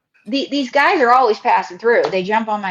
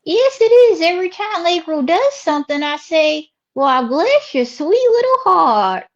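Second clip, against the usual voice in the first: Ruby Chapeau; 6 semitones higher than usual. Acted. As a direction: play the sweet Southern lady, accent on thick. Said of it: "this is good Southern hospitality".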